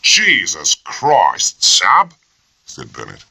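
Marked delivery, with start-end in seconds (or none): 0.0-2.1 slow, loud, scornful outburst; mock his colleague with open contempt; officer jeering at his junior, louder and slow. A narrator's reading. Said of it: "a barrage of scorn and sarcasm".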